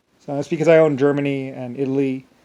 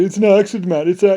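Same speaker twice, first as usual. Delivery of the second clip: mock pilot pleading over the radio; a hint of a rattled fighter pilot; panicked pilot; 5 semitones higher, 6 dB louder than usual. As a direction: quote him crying accident, pitched up. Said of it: "he said".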